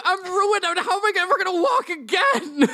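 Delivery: very high, hammed-up anguished wail; injured doctor wailing. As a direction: lament your wrecked knees in a high voice. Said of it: "Doctor LaBelle is just, like, clutching his knees".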